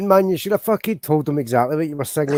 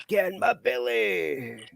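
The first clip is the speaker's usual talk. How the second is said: higher, softer and very slow greedy Scottish croon; Fat Bastard impression; 6 semitones higher, 5 dB quieter, much slower than usual. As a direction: draw the line out quietly with a gloating relish. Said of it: "Austin Powers, that fat bastard".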